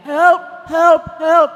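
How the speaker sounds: high, loud, short English cries; acted yell for help; shouting for help. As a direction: call out for rescue at full voice. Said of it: "At sumisigaw niya".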